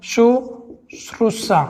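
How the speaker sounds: शुश्रूषा stretched out slowly, every syllable drawn apart; slow demo pronunciation. Shown as a demonstration. Teacher to exam students, spelling शुश्रूषा out syllable by syllable slowly. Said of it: "इसका शुश्रूषा इस प्रकार से हो जाएगा".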